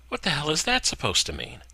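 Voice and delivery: Gina, indignant. A narrator's reading. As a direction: snap it back indignantly. Gina confronts Craig.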